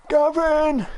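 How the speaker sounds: high drawn-out call. Acted. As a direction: call the name out, high and held. Calling out Gavin and Stacey name.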